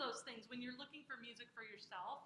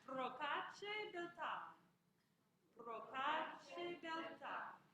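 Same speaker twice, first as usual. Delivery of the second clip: very slow, raised "Procace belta" with weight on "TA"; accented final syllable; 3 semitones higher, much slower than usual. Shown as a demonstration. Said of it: "there's an accent on that one".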